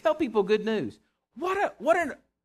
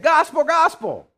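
"Gospel, gospel" called out much higher and louder; jubilant angel proclamation; 8 semitones higher, 8 dB louder than usual. Retold or imitated. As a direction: announce it like angels swooping down from the sky. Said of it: "they swooped down and said".

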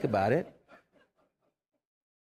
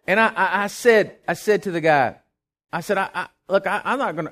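heated outburst; 6 semitones higher, 7 dB louder than usual. Retold or imitated. Speaker fired up retelling anger.

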